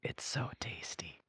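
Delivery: teasing breathy aside; whisper. Acted. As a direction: whisper it like a tease. Teasing aside while John drinks.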